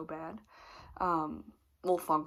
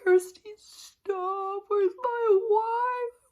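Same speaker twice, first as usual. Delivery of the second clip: higher, slow, hammed-up wailing husband; mocking, drawn-out crybaby wail; mock sobbing; 8 dB louder, much slower than usual. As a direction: imitate him blubbering for his wife. Husband freaking out, crying, retold.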